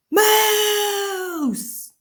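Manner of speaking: very high shout of the word; yelled Moose. A narrator's reading. Father steps outside and yells at the moose. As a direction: cry out in shock. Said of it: "opened them up and yelled".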